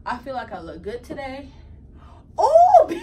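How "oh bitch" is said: shrieking with laughter; high scream of a laugh. Real, not acted. Dog makes a weird face.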